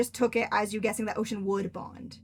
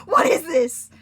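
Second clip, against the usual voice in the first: higher, louder, hammed-up alarm; mock worried question; 7 semitones higher, 12 dB louder, much slower than usual. Asking Casey about the sharp pain in mock alarm. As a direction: fake panic about the pain. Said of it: "And I was like".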